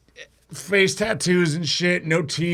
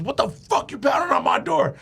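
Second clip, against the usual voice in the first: angry retold yell; 8 semitones higher than usual. [name] confronts whoever pounded on his door, angry mock yell. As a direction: imitate an outraged neighbor calling out.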